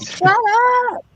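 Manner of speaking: high-pitched mimicked telling-off; mock scolding. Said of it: "She was like".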